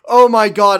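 loud sudden exclamation; excited outburst. Real, not acted. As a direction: burst out in excitement.

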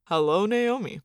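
man's version of fake-nice politeness. A narrator's reading. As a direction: return the greeting with put-on niceness. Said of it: "his version of the fake nice voice".

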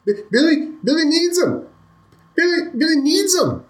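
put-on a panicked child blurting it out as he runs; frantic little boy. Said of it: "you hear the little boy say".

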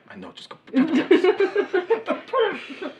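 high, slow, giggling repeat of the order; captain laughing as she repeats herself. Acted. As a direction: laugh through the command.

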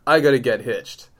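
ordinary delivery

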